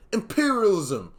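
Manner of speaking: high, loud outcry of one word; imitating someone screaming it out; mock scream. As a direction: cry it out like an alarmed accuser. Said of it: "someone immediately screams out one word".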